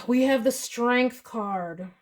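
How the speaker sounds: sigh, then "We have the Strength card" slow and drawn out; sigh then slow line. Real, not acted. Reading card, sighs, repeats.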